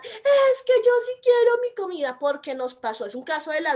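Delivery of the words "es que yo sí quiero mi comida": put-on very high, loud child's tantrum scream; imitating a screaming little daughter. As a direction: shriek it like a little girl demanding food. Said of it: "la niña comienza a gritar".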